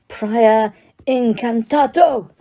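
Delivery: slow, drawn-out spell roar. A narrator's reading. Diggory casts at the wand, roaring slowly. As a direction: cast it with a swelling, ringing cry. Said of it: "roared Mister Diggory".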